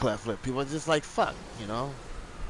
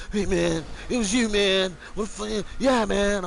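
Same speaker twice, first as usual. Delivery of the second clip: higher, louder mock taunt; taunting kids; 9 semitones higher, 3 dB louder than usual. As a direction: needle him like schoolkids. Kids taunting him at school.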